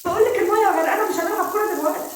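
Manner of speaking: panicked shout. Acted. Daughter panicking over the flood.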